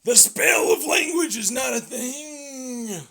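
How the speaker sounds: slow, loud, high indignant shout; mock outraged shout. Mock zealot shouting down the critics, slow and loud. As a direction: bellow the denial slowly like a zealot.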